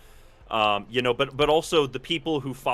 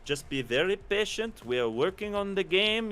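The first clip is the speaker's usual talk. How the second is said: put-on higher-pitched shoddy French accent; bad French accent; 6 semitones higher than usual. Developer telling an eager fan to wait, bad French accent. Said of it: "shitty French accent".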